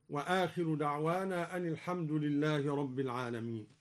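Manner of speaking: ordinary talk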